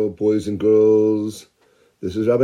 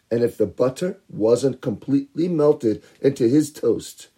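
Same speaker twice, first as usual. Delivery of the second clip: ordinary delivery, nothing marked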